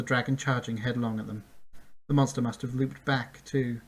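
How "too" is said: usual speaking voice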